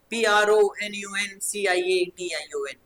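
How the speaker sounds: rhythmic, connected spelling-out; grouped spelling. Shown as a demonstration. Teacher showing pupils how to memorise, grouped letters recited in one run. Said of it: "टुकड़ों में".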